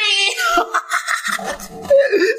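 shrill burst of laughter; high cackling laugh. Acted. High laugh after wailing.

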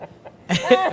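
loud, high helpless laugh; burst of high laughter. Real, not acted. Host bursts out laughing at his radio gaffe, high and loud. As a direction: crack up at his own blunder. Caught in his own embarrassment.

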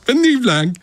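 high, sneering mock plea; mock request. Host mimicking the campaign asking comics for jokes, sarcastic high voice. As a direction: parody a boss begging for jokes, pitch up. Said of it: "puis tu dis".

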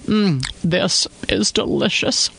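lightly voiced talking through food; mouth full. A narrator's reading. Wife tasting husband's cooking, mouth full. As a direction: speak as if chewing. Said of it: "she said with her mouth full".

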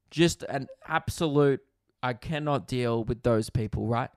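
ordinary talk, nothing marked

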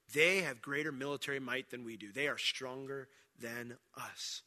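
low, soft, slowing at the end; sober statement of fact. Retold or imitated. Spies giving their true reason why, low sober statement. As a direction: state it quietly and deliberately. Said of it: "That wasn't a false statement".

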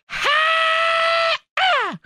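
drawn-out high whoop; long high-pitched shout. Acted. Host hyping piled-up fish fries in an ad, long high whoop. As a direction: shoot the voice way up and hold it.

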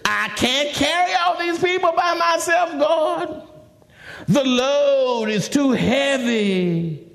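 high, overburdened wail to God; exasperated plea, slowing heavily at the end; frustrated Moses. Acted.

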